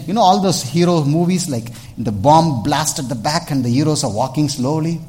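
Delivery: ordinary delivery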